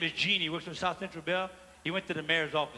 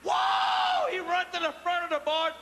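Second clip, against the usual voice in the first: excited whoa; 9 semitones higher, slower than usual. Acted. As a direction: cry out as the gorilla charges. Gorilla lunges, narrator exclaims.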